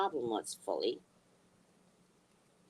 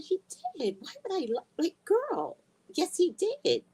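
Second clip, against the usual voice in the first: sassy retort. Retold or imitated. Arguing back at daughter-in-law.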